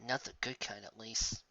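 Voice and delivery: soft grumbling afterthought; muttered aside. Acted. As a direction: mutter it to herself. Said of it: "she added in a mutter".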